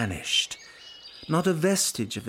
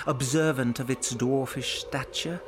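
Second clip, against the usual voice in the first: plain reading voice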